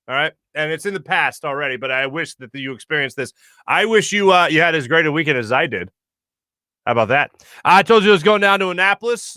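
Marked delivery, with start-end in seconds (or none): none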